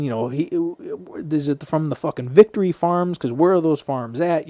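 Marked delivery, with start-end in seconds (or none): 1.3-4.5 louder, higher challenging questions; demanding, mock-indignant questioning; retold scene-making. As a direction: act out someone making a scene. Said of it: "She's making a scene".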